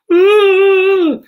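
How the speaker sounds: high, loud drawn-out howl; imitated suspect's wail. Suspect wails while the dog holds on, howling. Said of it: "this suspect going".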